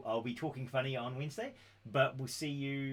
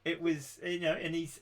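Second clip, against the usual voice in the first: laughing; 6 semitones higher, 8 dB louder than usual. Real, not acted. Laughing while finishing the story.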